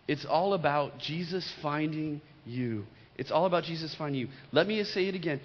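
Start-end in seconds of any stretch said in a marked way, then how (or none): 0.1-2.9 slow and deliberate, leaning on all. Real, not acted. Preacher making the point sink in, slow. Repeated line, slow take. 3.2-4.3 fast, tossed-off repeat; quick. Real, not acted. Preacher repeating the point, fast.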